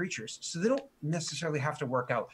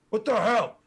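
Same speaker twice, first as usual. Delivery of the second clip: high, loud indignant orc; annoyed orc; 7 semitones higher, 6 dB louder than usual. Acted. First orc snaps at the one who interrupted. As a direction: bark it at the rival. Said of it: "he's like".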